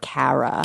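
"Kara" with the flat a of "cat"; ex's pronunciation. Retold or imitated. Showing how my ex says my name, imitated pronunciation. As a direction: say "Kara" as "KA-ruh", first vowel as in "cat". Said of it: "He says, like".

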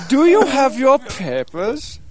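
slow, high German interrogator; drawn-out cartoon German questioning; mock German accent. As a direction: demand it in a hammed-up German accent. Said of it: "only make fun of German accents".

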